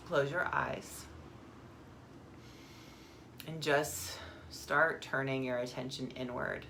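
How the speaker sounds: calm meditation guide, long pauses; guided meditation. Acted. Guide turns listeners inward to the breath, calm meditation voice. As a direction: ease them into stillness, phrase by phrase.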